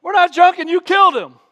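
high, loud declaration; bold sermon quote. Retold or imitated. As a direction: hammer it home to the crowd. Quoting Peter's sermon.

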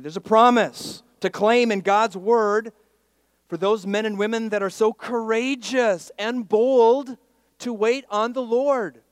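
loud, high, rousing proclamation; full-force preaching. Real, not acted. Preacher tells church of promise to claim, full-force proclamation.

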